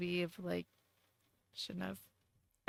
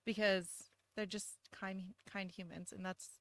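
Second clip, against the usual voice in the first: close to crying; 10 dB quieter than usual. Real, not acted. Mom proud of kind kids, close to tears. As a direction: speak softly as emotion takes over. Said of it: "I'm gonna cry".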